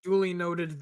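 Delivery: ordinary delivery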